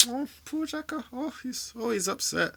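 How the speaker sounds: high, falsetto mock sympathy; mock pity. Mocking Xhaka's upset to stream viewers, falsetto mock pity. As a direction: whine it like fake pity.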